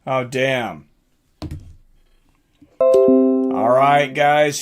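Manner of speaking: ordinary delivery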